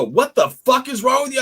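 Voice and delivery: retold yell. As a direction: shout back at her in disbelief. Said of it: "I go".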